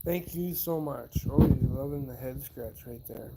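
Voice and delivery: ordinary talk, nothing marked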